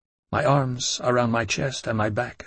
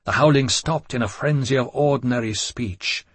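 ordinary delivery

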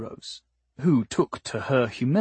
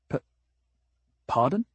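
stammering on the first sound; stutter; faster than usual. Acted. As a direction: trip over the P of pardon. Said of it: "Ort stuttered".

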